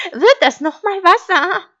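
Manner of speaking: very high, loud sheep cry; sharp, piercing voice. A narrator's reading. Sheep challenging the stranger at the door, sharp voice. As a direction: call it out high and pointed. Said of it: "rief Schaf mit spitzer Stimme".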